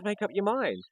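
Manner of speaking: high, loud exasperated mock yell; retold yelling. Man by the fridges demanding a decision, retold yelling. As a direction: imitate hollering from the back of a shop. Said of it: "he's yelling out from the back of the server".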